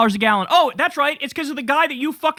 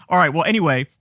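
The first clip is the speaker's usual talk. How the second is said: ordinary talk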